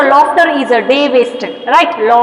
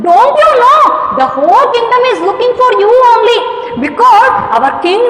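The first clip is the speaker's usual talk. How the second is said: excited urgency; 8 semitones higher than usual. Retold or imitated. Boy urgently telling the news.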